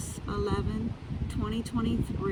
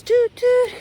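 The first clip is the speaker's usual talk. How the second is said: much higher and louder toot; train whistle toot; 11 dB louder than usual. Retold or imitated. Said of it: "and goes".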